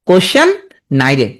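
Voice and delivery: "কোশ্চেন" rising, pause, then "নাই রে"; mnemonic pronunciation. Shown as a demonstration. Teacher drilling the spelling trick, in two separate pieces. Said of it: "তুমি এইভাবে উচ্চারণ করবা".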